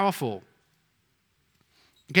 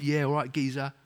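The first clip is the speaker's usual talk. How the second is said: put-on softer, casual London accent; a London man answering a greeting in a relaxed London accent; 2 semitones lower, 7 dB quieter than usual. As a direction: greet him like a London geezer. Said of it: "a real kind of London accent".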